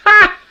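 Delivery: loud, high scoffing "Ha!"; one sharp mocking laugh; scornful bark of a laugh. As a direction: scoff at the very idea. Scoffing at needing a license.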